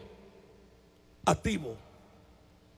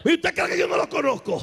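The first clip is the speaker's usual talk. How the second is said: mock threat; 6 semitones higher than usual. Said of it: "Me dijo así".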